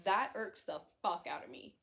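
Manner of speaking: ordinary talk, nothing marked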